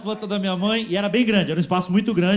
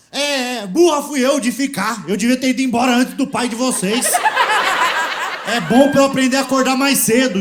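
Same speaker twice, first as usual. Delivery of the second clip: imitating an angry mother, pitched up and louder; mãe brava; 5 semitones higher, 3 dB louder than usual. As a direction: scold the kids as a fed-up mother. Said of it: "ela tava brava com a gente". (mãe brava dando bronca, imitada)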